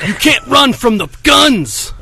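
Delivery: high, slow shouted boast; wrestling promo shout. Acted. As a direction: flex and bellow at the crowd.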